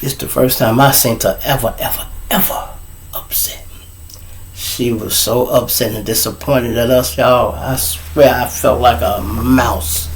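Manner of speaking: soft, low, shrinking delivery; hushed dismay. Retold or imitated. Student recalling Miss Washington upset, hushed and small. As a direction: drop to a hush, almost voiceless. Narrator awed by her upset.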